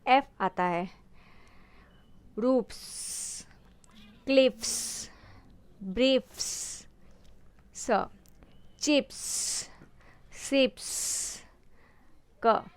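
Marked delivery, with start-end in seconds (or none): 2.4-6.4 "रूफ्स", "क्लिफ्स", "ब्रीफ्स" each with a hissed final s; voiceless s ending. Shown as a demonstration. Teacher drilling plurals, "रूफ्स", "क्लिफ्स", "ब्रीफ्स" ending voiceless. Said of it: "voiceless sound". 8.8-11.4 "चिप्स" and "सिप्स" with a long hissed s; voiceless s ending. Shown as a demonstration. Teacher drilling plurals, "चिप्स" and "सिप्स" ending voiceless. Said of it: "स के sound आ रहा है".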